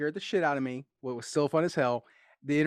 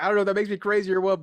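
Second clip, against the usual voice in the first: excited rush; 6 semitones higher, 6 dB louder, faster than usual. Retold or imitated. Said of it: "I was like".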